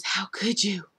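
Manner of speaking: hushed whisper of hurt; whispered accusation. Retold or imitated. Sammy at Marlena's door, whispered. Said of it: "and says".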